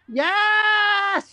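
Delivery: high, loud held squeal of joy; excited squeal. Acted. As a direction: squeal the yes. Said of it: "I was so excited".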